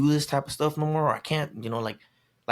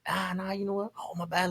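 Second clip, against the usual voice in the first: higher, softer apologetic voice; retold backing down; 6 semitones higher, 5 dB quieter than usual. Him to a stranger as trouble starts, placating. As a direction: imitate easing out of trouble, light and high.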